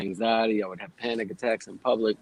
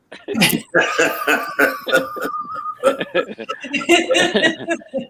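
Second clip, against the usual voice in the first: loud, high burst of real laughter; big laugh; 5 dB louder than usual. Real, not acted. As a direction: break into a long loud laugh. Guest's joke about shooting.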